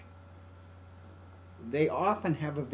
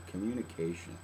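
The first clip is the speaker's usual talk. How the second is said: soft echo; 5 semitones lower, 6 dB quieter than usual. Shown as a demonstration. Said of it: "the big one is communication".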